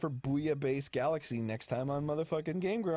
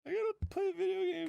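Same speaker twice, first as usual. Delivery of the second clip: put-on whiny teen. Mock teen whining.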